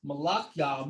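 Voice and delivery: "Malachi" as mah-LAH-kyah; again the Hebrew form mah-LAH-kyah, stress on LAH; Hebrew pronunciation. Shown as a demonstration. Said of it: "or Malachi".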